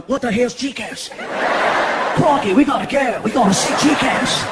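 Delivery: broad put-on Australian; Australian accent. Australians watching the DVD, mock Australian accent. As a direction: do a hammed-up Aussie tourist accent. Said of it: "people in Australia going".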